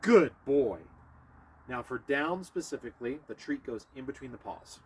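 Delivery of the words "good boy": loud, happy praise for the dog. Real, not acted. Trainer praising Bear after he sits, loud and happy. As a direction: cheer the dog on for getting it right.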